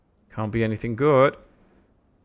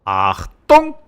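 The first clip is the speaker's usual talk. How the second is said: loud mock German bark, pitched low; German shout; 4 semitones lower, 12 dB louder than usual. Streamer playing up the German accent for chat, loud mock German. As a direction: bellow it like a cartoon German officer.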